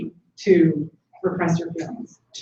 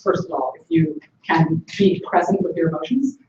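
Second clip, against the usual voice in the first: usual speaking voice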